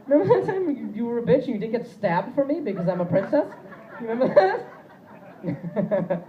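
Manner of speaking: high, loud mocking princess voice; mocking girlfriend. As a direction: needle him like a spoiled princess, way up high. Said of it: "she's just gonna pull out that card and be like".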